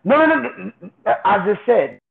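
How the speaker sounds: ordinary talk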